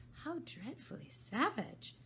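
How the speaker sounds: high, slow shocked Alice; a little girl appalled; shocked exclamation. Acted.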